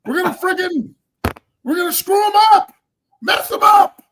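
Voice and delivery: high, loud, building hammed-up pep rally; mock hype coach. Mocking the coach's clean-mouthed hype speech, high hammed-up shouting building to a shout.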